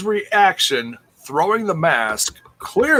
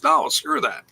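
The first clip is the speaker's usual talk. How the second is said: mock scared refusal; 12 semitones higher than usual. Voicing Dez refusing.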